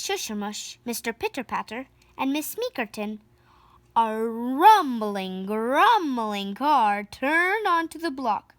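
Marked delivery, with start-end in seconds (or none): none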